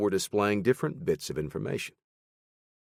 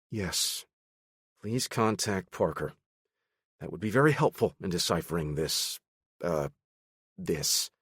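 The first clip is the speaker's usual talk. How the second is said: edge in tone. Acted. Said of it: "the edge in Travis's tone".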